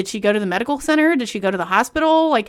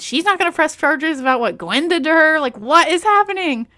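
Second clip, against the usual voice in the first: incredulous; 6 semitones higher, 4 dB louder than usual. Real, not acted. Incredulous reaction to plot hole.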